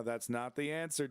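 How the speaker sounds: ordinary talk, nothing marked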